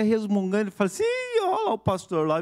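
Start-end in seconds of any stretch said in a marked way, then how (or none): 1.0-2.4 very high-pitched imitation of a gossiping onlooker; exaggerated high mock exclamation. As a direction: squeal it like a bystander pointing someone out. Imitates onlooker commenting on grumpy pastor.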